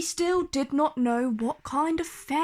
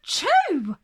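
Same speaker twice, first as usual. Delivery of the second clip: loud sneeze, higher; one more forceful achoo; hard sneeze; 8 semitones higher, 5 dB louder than usual. A narrator's reading. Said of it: "She sneezed so hard".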